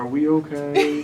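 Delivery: high, loud, drawn-out mock-nervous question; nervous student. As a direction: imitate an anxious student, slowly, amused. Voicing a nervous student, laughing.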